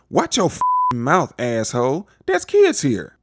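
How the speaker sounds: loud, high-pitched telling-off, played for laughs; hammed-up outrage, climbing to a high yell; mock outraged scolding. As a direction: scold a stranger loudly, imitating an offended bystander. Retold bystander scolding a curser.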